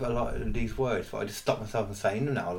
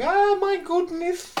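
squeaky panicked voice; 8 dB louder, much slower than usual. Retold or imitated. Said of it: "he's like".

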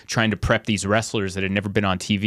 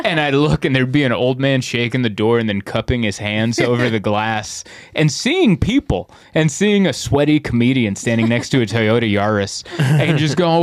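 ordinary talk, nothing marked

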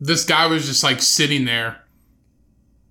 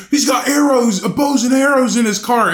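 imitating protesters, higher and louder; mock panicked protester; 8 semitones higher, 5 dB louder than usual. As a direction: fake alarm calling for backup. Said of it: "He's like".

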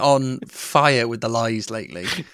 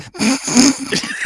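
high-pitched snigger, a little louder; mock sniggering; 13 semitones higher, 3 dB louder than usual. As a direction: snicker in the background like a mean kid. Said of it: "Just us going, ... just sniggering".

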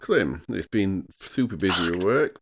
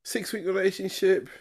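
much higher, disbelieving echo; incredulous; 13 semitones higher than usual. Real, not acted. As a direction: repeat it in mock disbelief. Echoes partner in disbelief.

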